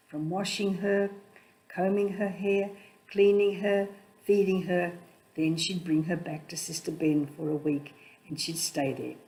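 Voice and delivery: ordinary delivery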